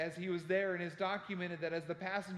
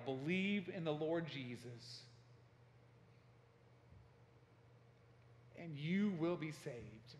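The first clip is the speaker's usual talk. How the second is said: retold yell; 4 dB quieter, slower than usual. Harper in the water changes his battle cry, slow retold yell. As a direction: stretch the retold cry out slowly. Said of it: "he began to yell".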